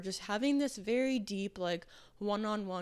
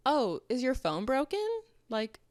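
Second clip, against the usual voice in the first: higher, louder mock-innocent sarcasm; savage sarcasm; 6 semitones higher, 4 dB louder than usual. Roommate roasting a guy on a dating app, sarcastic. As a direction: needle him with fake concern. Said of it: "she will respond so savagely".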